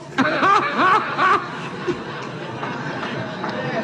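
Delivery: laughing hard, high and falling; long high laugh. Real, not acted. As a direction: let a long laugh roll out. Long laugh after joke.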